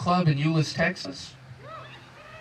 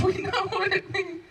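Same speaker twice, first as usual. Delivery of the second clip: usual speaking voice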